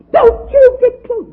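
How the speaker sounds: high, loud, slow mock-scream of a frantic mother; mother's scream. Preacher imitating his mother screaming at him near a pool, shrill mock yell. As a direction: shriek the warning like a panicked mom at the pool. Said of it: "screaming at me".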